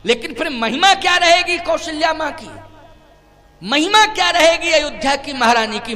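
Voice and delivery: high, loud preaching; impassioned rhetorical question. Real, not acted. Preacher questions what glory Kaushalya would keep, loud and impassioned. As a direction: throw the challenge out to the congregation.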